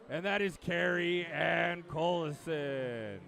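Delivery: slow, drawn-out name announcement; announcer's name reveal. Acted. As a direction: stretch the name out for the crowd, falling at the end. Announcer presents a senior swimmer.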